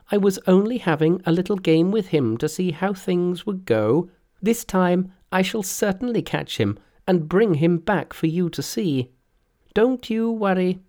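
high, lively trickster voice; high cocky Monkey voice; Monkey's voice. Acted. Monkey speaks in story.

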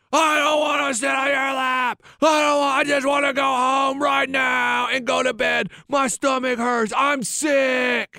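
high, whiny mock kid protest; wailing five-year-old. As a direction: imitate a child's stretched-out tantrum. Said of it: "And he goes".

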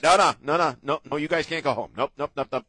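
mock-stern refusal; loud insistence. Real, not acted. Insisting colleagues stay.

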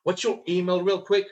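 breezy offhand question; casual sales tonality. Shown as a demonstration. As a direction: ask it as if it's nothing. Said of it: "Tonality, always, to-, again, tonality".

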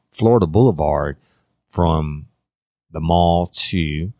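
ordinary talk

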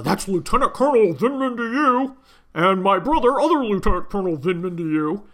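high, loud mocking voice; pompous mock officer. Mocking the Vindmans' pompous correction, high and loud.

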